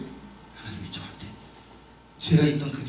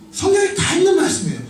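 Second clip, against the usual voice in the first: emphatic repeat; 4 dB louder than usual. Real, not acted. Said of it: "그 말씀이 성경에 다 있는 말씀이에요".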